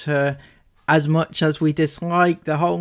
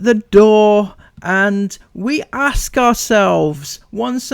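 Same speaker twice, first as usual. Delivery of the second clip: dramatic teaser; 4 semitones higher than usual. Acted.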